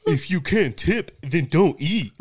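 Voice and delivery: high, loud mocking caricature; mocking server impression. Griping diner mimics people demanding tips, high loud mocking voice. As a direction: mimic them shrilly at full volume, mockingly. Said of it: "When bitches are like".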